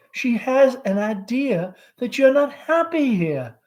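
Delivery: high nervous Southern mother; anxious mother Amanda. Acted. Amanda voiced by reader.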